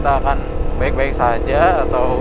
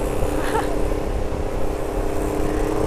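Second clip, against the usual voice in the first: high breathy giggle; 4 dB quieter than usual. Real, not acted. Laughing about the exciting story.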